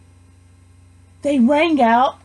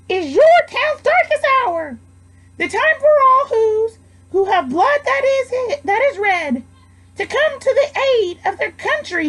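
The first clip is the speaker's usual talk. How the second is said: high tiny-voiced cry; mayor crying out; 11 semitones higher than usual. Mayor rallying his town from the tower, tiny high cry. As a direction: cry it out, small and urgent. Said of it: "cried the mayor".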